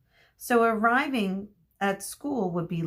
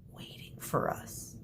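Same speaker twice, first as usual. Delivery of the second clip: softly whispered, much quieter; 10 dB quieter than usual. Acted. Chiron hoping friends wait inside, whispered. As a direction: drop to a hushed whisper.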